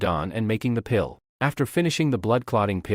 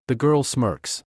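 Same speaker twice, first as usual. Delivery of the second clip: usual speaking voice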